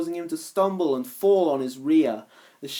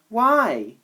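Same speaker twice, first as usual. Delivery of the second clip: feigned indifference; 7 semitones higher, 5 dB louder than usual. Acted. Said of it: "pretending not to care".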